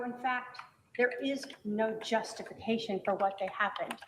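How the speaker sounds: ordinary talk, nothing marked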